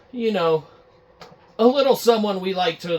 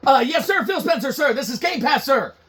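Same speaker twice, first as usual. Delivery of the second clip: nervous employee; 9 semitones higher, 4 dB louder than usual. Acted. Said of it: "Guy's like".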